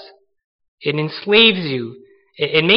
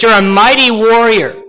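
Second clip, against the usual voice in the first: higher, louder heavenly proclamation; angel proclaiming; 5 semitones higher, 5 dB louder than usual. Acted. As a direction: call him a mighty warrior with full force. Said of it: "He says".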